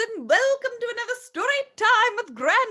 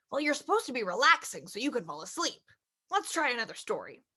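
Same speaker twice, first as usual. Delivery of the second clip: low, soft father voice; a gentle low dad tucking in his child; reading the father's lines low and soft; papa voice; 10 semitones lower, 9 dB quieter than usual. Acted.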